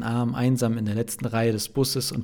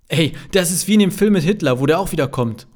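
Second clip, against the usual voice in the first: loud, high-pitched excited call; aufgedrehter Zuruf; 6 semitones higher, 7 dB louder than usual. A narrator's reading. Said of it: "ruft Kevin". (aufgedrehtes lautes Rufen)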